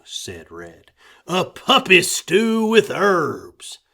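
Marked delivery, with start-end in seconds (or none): 1.2-3.8 sneering joke. A narrator's reading.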